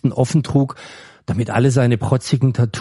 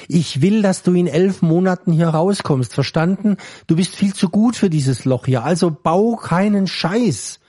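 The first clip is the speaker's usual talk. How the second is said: higher, urgent prison guard pleading; worried guard; 6 semitones higher than usual. Acted. Guard to prisoner, urging him to stay out of trouble, earnest. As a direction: warn him like a worried friend in uniform.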